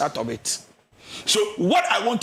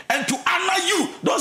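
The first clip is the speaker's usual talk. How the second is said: rising to a shout; 11 semitones higher than usual. Real, not acted. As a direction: lift it until you are shouting. Counselor builds to a shout.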